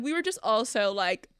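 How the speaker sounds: ordinary talk, nothing marked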